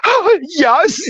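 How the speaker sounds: very high, breathless delight; flattered high-pitched yes; giddy squeal. Retold or imitated. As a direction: squeal it like a thrilled fan. Thrilled at being called birder.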